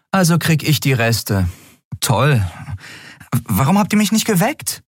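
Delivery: a hint of a tired captain waking up; captain Endriel voice. Captain Endriel asking the crew about breakfast, lightly voiced.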